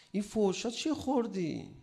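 preacher acting a doctor asking the patient what he ate. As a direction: play the doctor quizzing the patient. Doctor questioning patient, acted dialogue.

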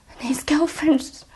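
high voice breaking into tears; real choking up. Real, not acted. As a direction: fight back tears, then give up and apologise. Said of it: "I got to stop".

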